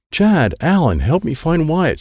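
high-pitched, urgent call; yelling for help. A narrator's reading. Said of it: "Sensei yelled".

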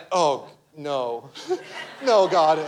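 laughing mock dread. Dread at being called to share.

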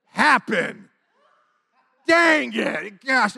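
loud, high mock exasperation; exasperated outburst. Teller realizing God answered, exasperated outburst. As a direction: burst out in comic frustration.

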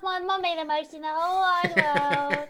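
put-on high little baby voice; Fish. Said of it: "Fish is the little baby voice".

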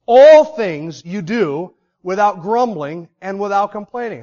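"All things" slow and loud; stress on all. Shown as a demonstration. Preacher drives home "all" to the church, slow stress. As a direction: lean slowly on "All things". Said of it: "bolding it".

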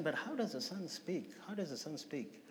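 very soft, fading away; retold private puzzling, hushed; musing to himself. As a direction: wonder it quietly to yourself. Retold puzzling to himself all night.

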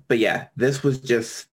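ordinary talk

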